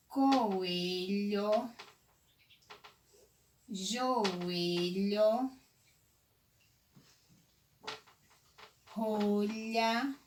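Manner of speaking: words drawn out with long pauses; slow dictation. Shown as a demonstration. Teacher finds LH words for pupils, slow dictation. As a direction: stretch each word as if writing it.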